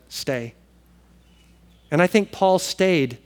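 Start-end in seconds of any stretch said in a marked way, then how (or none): 0.1-0.5 soft loving word. Retold or imitated. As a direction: let love whisper it. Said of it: "And I think love said".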